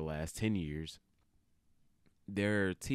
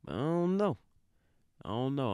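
exasperated; 7 semitones higher, 4 dB louder than usual. Real, not acted. Exasperated outburst.

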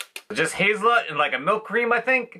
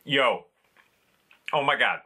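chewing while talking; speaking through a bite of wafer; mouth full; 6 semitones lower than usual. Real, not acted. Seen 2: bites at -3, cheeks full to 1.9, then face blurred.

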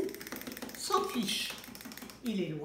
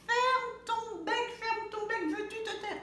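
whole farmyard yelling at once; everyone shouting; 10 semitones higher than usual. A narrator's reading. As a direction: shout the chick down together. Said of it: "se mettent à crier".

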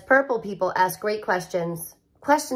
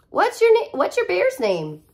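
put-on high eager child question; kid asking; 9 semitones higher, 3 dB louder than usual. Penny asks about a bear's name, high child voice. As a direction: ask like a curious little girl.

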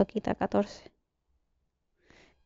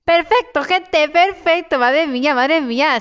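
elated; 5 semitones higher, slower than usual. Real, not acted. Celebrates getting away with loot.

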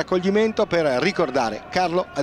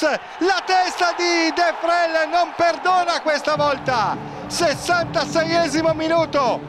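goal commentary; 5 semitones higher, much slower than usual. Acted. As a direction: shout the cross and the header. Second goal called.

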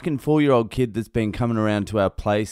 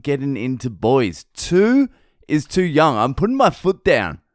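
higher-pitched, pleading protest; exasperated dad; 7 semitones higher than usual. Acted. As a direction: put your foot down like an overwhelmed dad. Acted exasperated dad scolding toddler.

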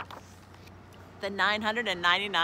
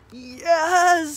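loud, higher cry of alarm; alarmed father frog; 7 semitones higher, 13 dB louder than usual. A narrator's reading. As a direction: burst out loudly at the danger. Father sees the snake, alarmed.

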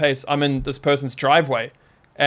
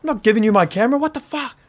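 high-pitched protest, a held-back scream; retold screaming back; 9 semitones higher, faster than usual. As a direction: imitate yourself yelling back in shock. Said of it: "Just screaming back at him".

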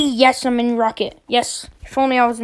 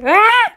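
sudden high yelp of alarm; startled yelp; 9 semitones higher, 5 dB louder than usual. Real, not acted. Player yelping when pushed into the ground. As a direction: yelp as the giant grabs you.

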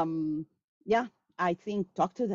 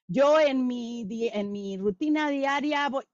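rote student recital; 6 semitones higher, 4 dB louder than usual. Retold or imitated. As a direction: imitate a student reciting by heart. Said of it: "They sit and they go".

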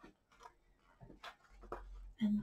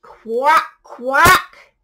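very loud quacks; quacking duck; 19 dB louder than usual. Acted. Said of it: "called the duck".

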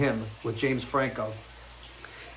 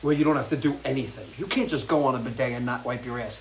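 ordinary delivery

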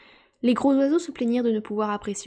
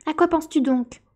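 scolding question, raised and higher; stern reproach; 7 semitones higher, 6 dB louder than usual. A narrator's reading. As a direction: demand an answer like a cross storyteller. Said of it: "d'un ton sévère". (question sévère, d'une voix plus haute)

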